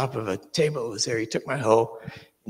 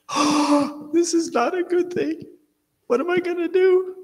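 very high, louder panicked voice; 6 dB louder than usual. Retold or imitated. Facing a big cobra up close, a high panicked cry. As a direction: squeal it in sheer panic. Cobra right in front of him.

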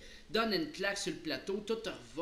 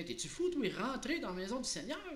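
high-pitched, disbelieving question; astonished old fellow; incredulous protest; 7 semitones higher than usual. Acted. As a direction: balk at a crazy suggestion, voice jumping up. Startled man objects to the goblin.

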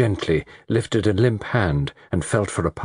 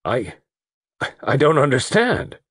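halting, then high bewildered protest; falter, then blurt it in shock; gasped; 7 semitones higher than usual. A narrator's reading. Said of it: "Barton Russell gasped".

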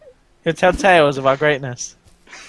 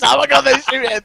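mock panic; 12 semitones higher than usual.

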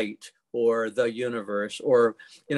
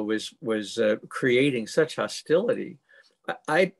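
usual speaking voice

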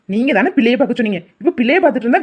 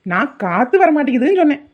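ordinary delivery

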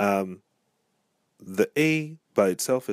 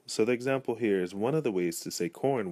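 ordinary talk, nothing marked

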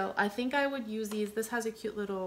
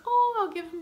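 high, louder burst of delight; playful delight; 10 semitones higher, 7 dB louder than usual. Retold or imitated. Retelling grooming her gnome, high delighted burst. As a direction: squeal it like a sudden fun idea.

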